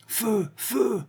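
high-pitched mimicked order; mocking echo. A narrator's reading. Druid repeats Vorsky's order back at him, mocking echo. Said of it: "répétait le druide".

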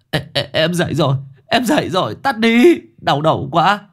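high, loud, dazed yelling from a locked room; a captive wakes screaming at the siren; groggy shouting. A narrator's reading. Captive yelling at the alarm, dazed and loud. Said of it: "vọng lên tiếng gào thét".

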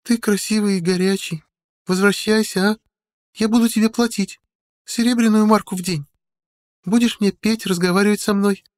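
put-on high girlish voice, coaxing; девушка Мариона. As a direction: proposition him as a girl, pitched far up. (высокий девичий голос, уговаривая)

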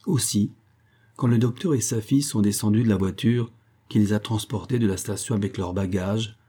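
ordinary delivery, nothing marked

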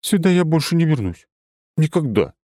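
high, firm, final vow; determined young woman. A narrator's reading.

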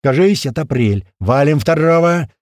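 shouted report. A narrator's reading. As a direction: shout the next target upstairs. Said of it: "кричит снизу". (громкий боевой выкрик)

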